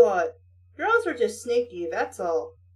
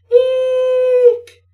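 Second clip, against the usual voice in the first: sudden high piercing eek, much louder; shrill scream; 11 semitones higher, 10 dB louder than usual. Acted. Startled scream from the vent. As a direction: shriek like you just saw a snake. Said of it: "A scream shot out from the vent".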